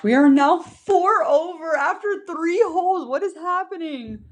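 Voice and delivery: can't believe the score; disbelief. Real, not acted.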